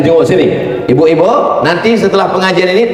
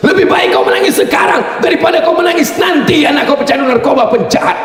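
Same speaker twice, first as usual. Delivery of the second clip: husband's shout; 12 semitones higher than usual. Retold or imitated. Husband scolding his crying wife, a high mock shout. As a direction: shout it at her high and hard.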